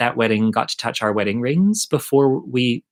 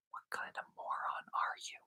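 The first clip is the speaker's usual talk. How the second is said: a hushed, scornful whisper; whispered thought about the late cook; inner whisper; 23 dB quieter than usual. Retold or imitated. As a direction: mutter it under your breath. What she thought in her head.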